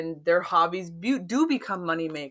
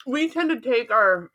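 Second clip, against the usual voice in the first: mouth full; 5 semitones higher, 4 dB louder than usual. Real, not acted. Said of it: "sorry, I'm eating some sushi right now".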